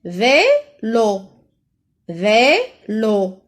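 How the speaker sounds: "Vélo" split vé-lo, clear gap between; two drawn-out beats on "Vélo"; syllable by syllable. Shown as a demonstration. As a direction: sound out "Vélo" for pupils. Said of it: "On a deux syllabes".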